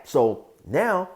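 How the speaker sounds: usual speaking voice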